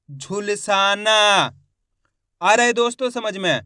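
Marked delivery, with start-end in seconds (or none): 0.1-1.2 झुलसाना slowly, with long सा and ना; syllable-by-syllable reading. Shown as a demonstration. Teacher blends letters for learners, slow syllabic झुलसाना. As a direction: stretch it out as झु-ल-सा-ना. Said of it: "एक एक अक्षर का उच्चारण".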